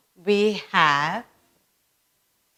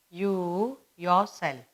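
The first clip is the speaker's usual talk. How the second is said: you yourself, spoken slowly and softer for writing down; slow dictation; 5 dB quieter, slower than usual. Shown as a demonstration. Teacher dictating the example for pupils to copy, slow and soft. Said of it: "The next we have is".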